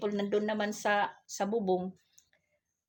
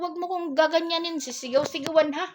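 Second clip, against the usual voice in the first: higher, louder mock scolding with a laugh; retold scolding; 9 semitones higher, 4 dB louder than usual. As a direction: tell him off, half amused. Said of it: "Sabi ko". (ginagayang pagsaway, may halong tawa)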